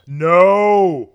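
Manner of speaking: long, high, drawn-out "no"; dismayed cry. Real, not acted. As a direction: wail it in drawn-out disbelief. Reacting to the shocking video.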